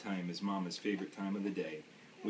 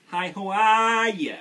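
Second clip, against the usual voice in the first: much louder, higher, slow Maine hello; biggest Maine voice; 11 semitones higher, 13 dB louder, slower than usual. Acted. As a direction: bellow the greeting in a big Maine accent. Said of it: "he said hello in his biggest Maine voice".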